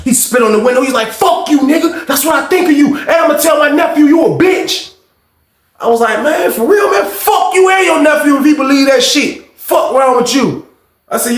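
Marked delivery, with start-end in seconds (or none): 1.1-4.9 high shouted insult; angry shouting. Retold or imitated. Unc spits and insults narrator. 6.2-10.7 high, laughing shouted comeback; laughing retort. Retold or imitated. Narrator cursing back, laughing.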